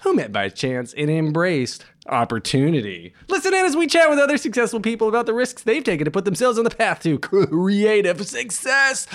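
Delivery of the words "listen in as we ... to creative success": rushed, higher, all in one breath. Pretend. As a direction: race through without breathing. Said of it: "Did that all in one breath".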